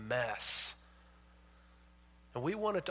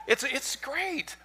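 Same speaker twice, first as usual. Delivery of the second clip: sarcastic; 12 semitones higher, 5 dB louder, much slower than usual. Acted. Ironic praise of cozy church.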